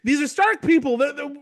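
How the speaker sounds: high-pitched, hopeful gushing; mock excited relief. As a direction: imitate someone naively thrilled to be rescued. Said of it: "Brienne is initially excited".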